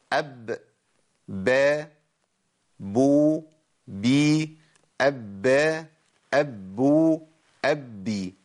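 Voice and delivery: أب, با, بو, بي said crisply one by one; letter drill. Shown as a demonstration. The sheikh repeats the cases of ب so students can follow. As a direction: recite the forms of ب clearly for students to copy. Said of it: "نجيبها تاني وركزوا معايا".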